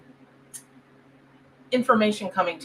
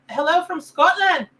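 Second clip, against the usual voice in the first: put-on Scottish accent; 7 semitones higher, 5 dB louder than usual. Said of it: "I gotta get that accent better".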